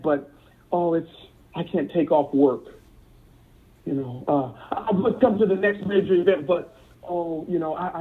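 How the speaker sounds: mimicking excuses. Acting out members' excuses.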